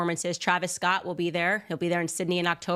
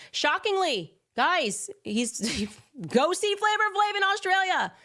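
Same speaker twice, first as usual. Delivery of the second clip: mock shock; 10 semitones higher than usual. Mock shock about [name].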